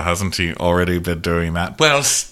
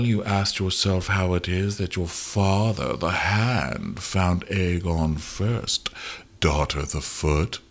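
slow, softer sinister drawl; sinister hint; 3 semitones lower, 5 dB quieter, slower than usual. Retold or imitated. Larys hinting to Alicent, slow and sinister. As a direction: let it hang like a creep with a secret.

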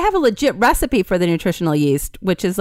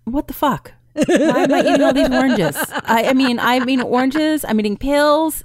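mock outrage; 5 semitones higher than usual. Real, not acted. Mock outrage over vitamin C.

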